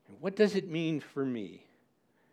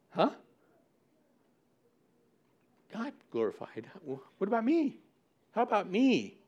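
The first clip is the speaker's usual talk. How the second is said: mock selfish whine; 7 semitones higher than usual. Preacher voicing selfish listener.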